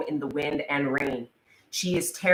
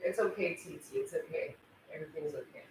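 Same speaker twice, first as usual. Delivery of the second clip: soothing whisper; 3 semitones higher, 13 dB quieter, faster than usual. Real, not acted. Soothing during the insect scare.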